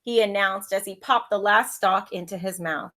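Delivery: lively read-aloud storytelling voice; story narration. A narrator's reading. Teacher reading a picture book to her pupils, lively storytelling.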